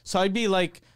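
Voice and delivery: ordinary talk, nothing marked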